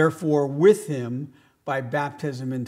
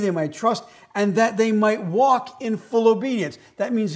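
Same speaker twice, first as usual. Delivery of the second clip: rising, louder preacher's call to go out; fervent sermon peak, louder and higher; preaching at full force; 7 semitones higher, 6 dB louder than usual. Acted. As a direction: drive it home like a pulpit climax.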